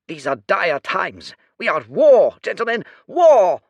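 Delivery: high, very loud "war!"; rally them with alarm; shrill shout. Acted. Bin insisting they are at war, a loud high shout. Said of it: "his voice was but a shrill squawk".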